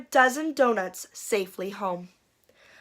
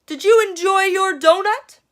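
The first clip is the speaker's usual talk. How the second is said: Mister Bryant; 10 semitones higher, 7 dB louder than usual. Acted. Mister Bryant teasing sheriff.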